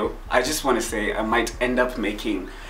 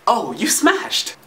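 teasing; 8 semitones higher, slower than usual. Acted. Teasing reacting to sign.

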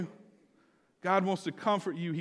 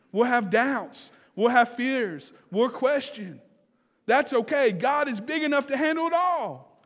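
raised, louder preaching voice; pitched up high, forceful reassurance; emphatic preaching; 7 semitones higher, 6 dB louder than usual. Real, not acted. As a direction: proclaim it to the congregation in punched phrases. Preacher builds assurance to congregation.

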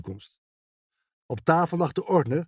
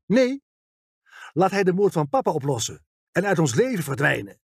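woedend; 5 semitones higher than usual. A narrator's reading. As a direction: cut in angrily with raised pitch. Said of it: "Haar ogen stonden woedend". (verontwaardigd en boos, met hoge stem)

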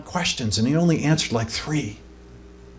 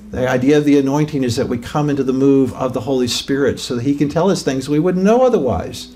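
usual speaking voice

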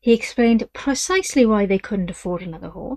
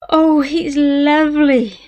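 slow, higher, louder gasp of delight; 7 semitones higher, 7 dB louder, much slower than usual. Acted. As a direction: swoon over the horse. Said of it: "she gasped".

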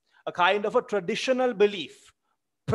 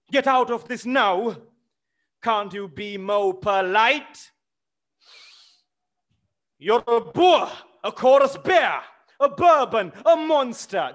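high, loud scornful outburst; angry insults; 6 semitones higher, 6 dB louder than usual. Acted. The widow calling Smirnov a bear, furious. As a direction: hurl the insults at him.

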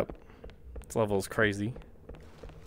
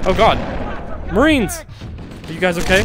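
startled shout; 7 semitones higher, 4 dB louder than usual. Real, not acted. Startled calling to Marines.

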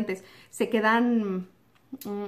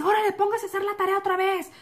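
higher, curt order from a strict teacher; bossy teacher; 8 semitones higher than usual. Retold or imitated. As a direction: bark it like a cross teacher. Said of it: "me dice". (maestra mandona, más aguda)

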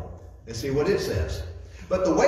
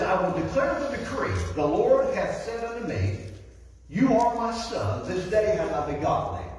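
scripture reading; 3 semitones higher than usual. Acted. Preacher reading Psalm two to the congregation, solemn. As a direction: intone the psalm line by line.

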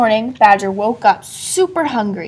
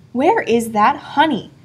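plain reading voice

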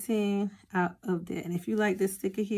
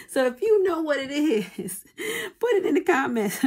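high, louder words through laughter; giggling request, pitched up; laughing plea; 8 semitones higher, 5 dB louder than usual. Real, not acted. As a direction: laugh while asking for help.

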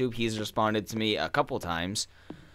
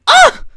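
demo noise; 19 dB louder than usual. Shown as a demonstration. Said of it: "the best noise a human being can make".

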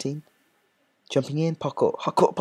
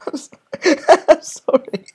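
laughing apology; 12 semitones higher, 7 dB louder than usual. Real, not acted.

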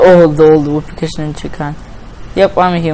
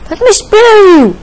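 high, loud, drawn-out plea; desperate shout; 6 dB louder than usual. Real, not acted. Player begging game monsters to be spared, desperate shout. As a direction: yell it at the top of your voice.

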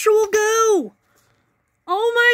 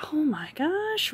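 whispered disbelief; 6 semitones lower, 9 dB quieter than usual. Real, not acted. Disbelief at the pet.

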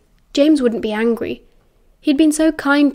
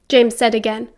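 usual speaking voice

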